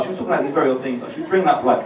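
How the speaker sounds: usual speaking voice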